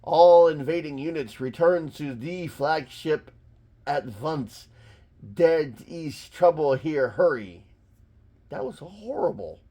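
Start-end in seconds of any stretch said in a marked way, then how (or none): 0.0-7.7 hammy fake German accent over the radio; clumsy put-on German officer accent; phony German accent. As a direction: give orders in a bad German accent. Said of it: "he tries to talk in a Nazi accent".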